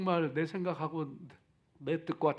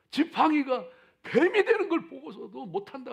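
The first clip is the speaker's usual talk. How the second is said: raised, exasperated divine scolding; stern rebuke; 9 semitones higher, 6 dB louder than usual. Retold or imitated. God rebuking Moses quoted.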